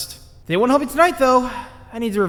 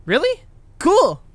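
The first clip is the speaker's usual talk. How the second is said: excited; 8 semitones higher, 6 dB louder, slower than usual. Acted. Boy delighted about puppy.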